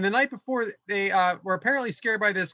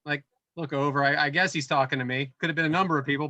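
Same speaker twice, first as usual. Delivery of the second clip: ordinary delivery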